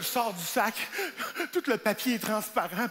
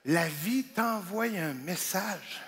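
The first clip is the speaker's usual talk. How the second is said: solemn self-talk; 5 semitones lower, 4 dB quieter, much slower than usual. Retold or imitated. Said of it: "je fais".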